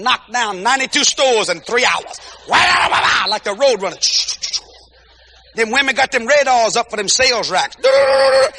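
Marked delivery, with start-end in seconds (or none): 2.5-3.3 road runner noise. Retold or imitated. Women racing through the mall. 7.8-8.5 mock sale spotting. Women spotting sales racks.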